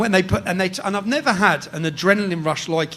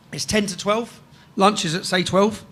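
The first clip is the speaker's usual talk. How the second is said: ordinary talk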